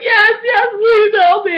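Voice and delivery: high, loud, wailing mock sob; crying plea. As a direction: act out begging for help through tears. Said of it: "And I'm crying".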